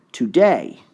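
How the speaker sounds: Today said falling, stress on TO; stress test on Today. Shown as a demonstration. Teacher testing stress on Today. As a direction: try Today with the first syllable strong. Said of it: "one you really have to hear".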